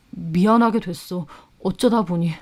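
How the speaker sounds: subdued, reluctant admission; sheepish boyfriend. Acted. Boyfriend confessing the affair to fiancée, sheepish.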